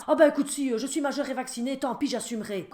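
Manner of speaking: put-on high, loud cocky teen; defiant teen son. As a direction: shrug it off like a stubborn adult son. Son defying mother, retold.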